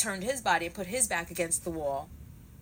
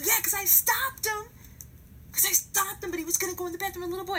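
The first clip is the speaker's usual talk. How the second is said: high-pitched, heated insistence; freaking out; 12 semitones higher, faster than usual. Retold or imitated. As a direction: protest frantically, pitched way up. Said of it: "freaking out".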